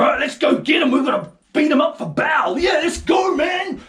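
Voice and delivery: loud, high call to go beat him; riled-up mob. Retold or imitated. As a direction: villagers rallying to attack Gideon. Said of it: "they were".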